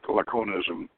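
usual speaking voice

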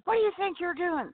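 high, offended man's voice; playing the outraged friend; mock indignant man. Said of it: "he says".

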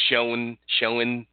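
"showing" stretched into two syllables, for shown; split into two syllables. Retold or imitated. Teasing a friend about his pronunciation, over-split syllables. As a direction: say "showing" with an extra syllable, SHOW-en.

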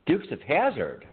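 ordinary delivery

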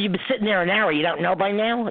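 mock the clerk's rude snap, lightly; rude retort. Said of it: "Just like that".

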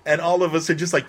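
ordinary delivery

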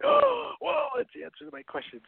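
high, delighted "oh, whoa"; amazed. Pretend. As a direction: blown away by the answer. Friend gives the answer.

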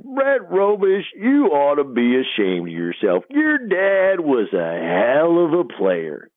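slow, high-pitched irate coach imitation; Coach Sutton. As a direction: shame the player with long drawn-out words. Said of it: "coach is hot".

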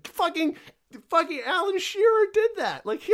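high, squeaky with laughter; words squeezed out laughing. Real, not acted. Laughing disbelief at Shearer.

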